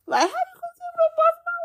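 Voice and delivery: squeaky high disbelief; shrill how-could-you protest; incredulous outrage. Retold or imitated. Preacher objecting to God's order to Lot.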